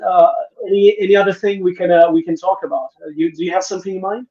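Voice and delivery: ordinary talk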